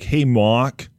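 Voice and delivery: light Boston accent on "Hey, Mark", dropping the r in Mark as "Mahk"; showing the host how a Bostonian says "Hey, Mark", r dropped. Shown as a demonstration. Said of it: "but in a Boston accent".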